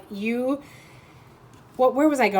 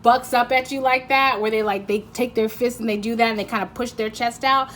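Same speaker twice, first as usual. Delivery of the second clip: usual speaking voice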